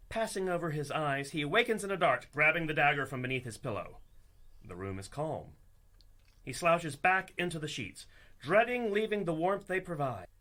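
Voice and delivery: normal talking voice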